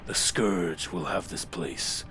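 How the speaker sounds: low, slow, whispery Lich King. Acted. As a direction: ancient lich, hushed and slow. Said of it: "I'm trying to get the Lich King voice back".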